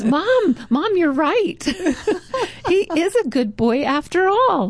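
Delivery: put-on high, laughing kid bursting with news; excited little boy. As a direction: run in thrilled to tell mom. Happy boy runs back to mom.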